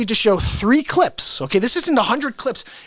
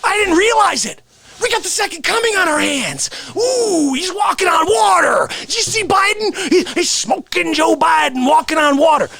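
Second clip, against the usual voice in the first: mock hype; 8 semitones higher than usual. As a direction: gush in a high, hammed-up shout. Mocking excited supporters' hype.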